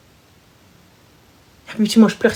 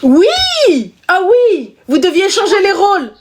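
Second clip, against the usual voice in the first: much higher, bright recognition; excited; 8 semitones higher, 3 dB louder, slower than usual. Real, not acted. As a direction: greet it with sudden excitement. Excited on the phone.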